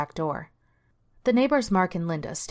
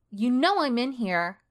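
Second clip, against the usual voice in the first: slow, higher sarcastic reply; 7 semitones higher than usual. A narrator's reading. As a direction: drip with teenage sarcasm. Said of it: "Sherry replied sarcastically".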